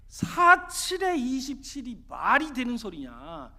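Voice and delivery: loud, drawn-out incredulous challenge; exasperated disbelief. Acted. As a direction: challenge it as utter nonsense. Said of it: "너무 어이가 없어가지고". (어이없다는 듯 큰 소리로 따지기)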